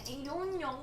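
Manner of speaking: high little singsong shrug; mock cluelessness. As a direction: play innocent in a high voice. Acting clueless answer to pestering.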